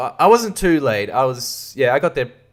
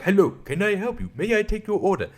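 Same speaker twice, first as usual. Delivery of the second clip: higher-pitched polite robot server; robot waiter; 7 semitones higher, 4 dB quieter than usual. Retold or imitated. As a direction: offer service as a robot, lifting the pitch. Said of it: "a robot walks up to the table and is like".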